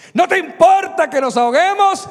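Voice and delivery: high, loud frantic shout. Acted. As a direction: yell it over the storm.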